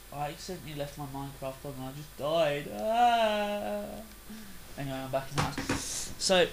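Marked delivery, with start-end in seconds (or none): 2.7-4.0 long, high wail; drawn-out cry. Retold or imitated. Gamer telling viewers he just died, drawn-out cry. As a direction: let out a sustained "ah", pitched up.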